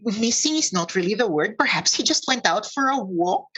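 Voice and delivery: hopeful, hedging centaur; centaur downplaying worry. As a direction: play down the worry in a lifted voice.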